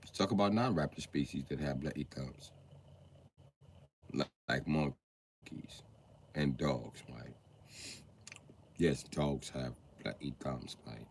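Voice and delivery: put-on low, soft British accent; British presenter. British man listing animals with thumbs, low British accent. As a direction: quietly lecture in British English.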